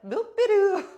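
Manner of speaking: high, loud singsong nonsense noise; silly high "boop-be-doo"; carefree boop-be-doo. Retold or imitated. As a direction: chirp a playful scat-like sound. Acts carefree while others argue.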